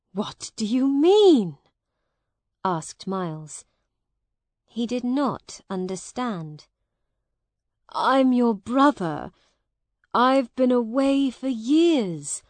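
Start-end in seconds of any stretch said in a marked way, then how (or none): 0.1-1.6 higher, louder startled question; baffled disbelief. Acted. Miles asks the brother who denies him, baffled. 7.9-12.4 louder, higher pleading insistence; urgent insistence. Acted. Returning brother insists to Hugh who he is, urgent.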